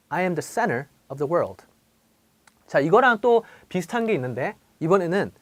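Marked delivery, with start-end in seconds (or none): 0.1-1.7 center with no T, like senner; dropped T. Shown as a demonstration. Teacher repeats the example pointing out the missing T. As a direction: swallow the T in center. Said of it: "모음과 모음 사이에 엔티가 있죠".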